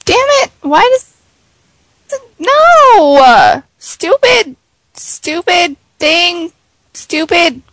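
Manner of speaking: high frustrated yells at the screen; frustrated outburst, pitch jumping up; yelling at computer. Real, not acted. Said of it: "I sit here and scream at my computer".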